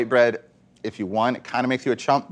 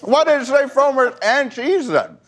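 laughing; 11 semitones higher, 3 dB louder than usual. Real, not acted. Confused by the bilingual label.

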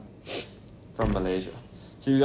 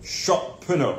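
high, loud, hammed-up British; British accent; 9 semitones higher, 5 dB louder, much slower than usual. Shown as a demonstration. Performing on friend's request, playful high British accent. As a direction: put on a British accent, pitched up. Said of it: "Say it in a British accent".

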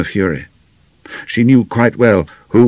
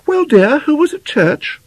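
very high-pitched motherly question; inquiring mother; 4 dB louder than usual. Acted. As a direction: ask your daughter in a high, chatty mother's voice. Mother greets returning daughter.

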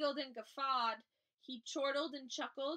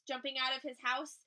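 usual speaking voice

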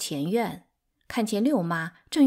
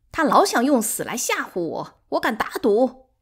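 loud, raised indignant retort; 气愤回击; 5 semitones higher, 5 dB louder than usual. A narrator's reading. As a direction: scoff angrily at the threat. Third wife answers in quarrel. (提高嗓门气愤地回击)

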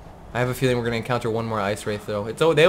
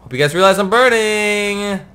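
loud complaint; 7 semitones higher, 5 dB louder than usual. Real, not acted. Complaining loudly to companions.

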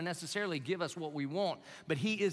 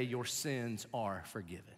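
soft slow; 6 semitones lower, 8 dB quieter, slower than usual. Acted. Jesus speaks forgiveness.

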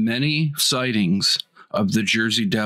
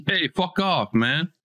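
mock Jersey brush-off, pitched higher; Jersey accent; 6 semitones higher than usual. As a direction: imitate someone from New Jersey telling you off. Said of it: "people from Jersey being like".

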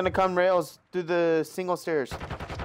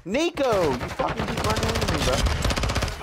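hyped gamer bro; 8 semitones higher, 4 dB louder than usual. Real, not acted. Yelling at teammate Nico.

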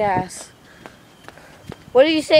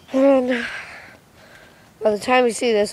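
ordinary delivery

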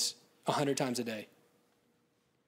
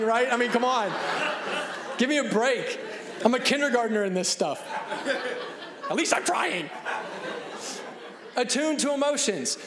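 higher, louder, laughing through it; 6 semitones higher, 3 dB louder than usual. Real, not acted. Speaker laughing at herself as a beginner, louder. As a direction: laugh at your own clumsiness.

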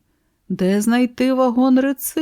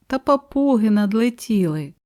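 usual speaking voice

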